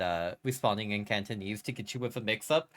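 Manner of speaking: ordinary delivery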